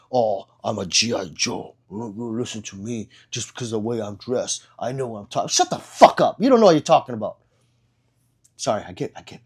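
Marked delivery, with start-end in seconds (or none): 0.1-5.5 lightly voiced, lower mock tough guy; a hint of mock soldier bravado; mocking gun tubers. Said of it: "They're trying to be like". 5.5-7.4 sudden loud, higher exasperated retort; heated telling-off; emotional outburst. Real, not acted. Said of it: "I get really emotional about it".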